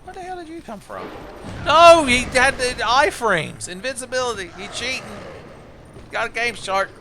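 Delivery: high, startled outrage at the enemy; outraged. Real, not acted. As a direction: cry out, caught off guard.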